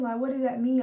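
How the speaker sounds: loud retold outburst; lashing out. Daughter retelling her confusion at responders, lashing out loudly. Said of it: "I lash out".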